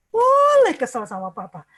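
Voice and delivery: loud boleh kesel, weight on boleh; emphatic allowance. Shown as a demonstration. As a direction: press boleh loudly to overturn the ban.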